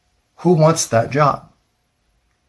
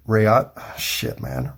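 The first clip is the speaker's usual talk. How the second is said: ordinary delivery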